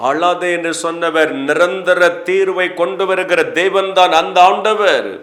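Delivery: forceful preaching. Acted. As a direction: declare it to the congregation with conviction. Preaching rises to full force. (உரத்த, உறுதியான பிரசங்கக் குரல்)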